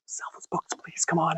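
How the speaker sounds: soft pleading whisper; whispered plea. Retold or imitated. Wife urging Meyer to sell the books, whispered plea. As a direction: whisper it like begging him.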